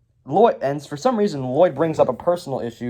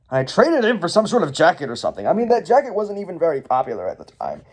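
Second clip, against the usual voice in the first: fast, higher offhand backtrack; quick, higher throwaway explanation; Garmadon; 4 semitones higher, faster than usual. Acted. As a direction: rattle it off dismissively. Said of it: "And then Garmadon's like".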